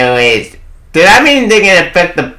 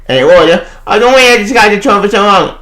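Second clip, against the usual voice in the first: ordinary talk, nothing marked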